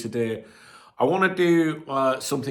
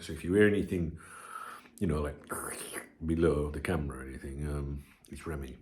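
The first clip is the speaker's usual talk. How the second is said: hushed whisper so as not to disturb the dog; 8 semitones lower, 9 dB quieter than usual. Real, not acted. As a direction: drop to a whisper. Dog lying under the tripod.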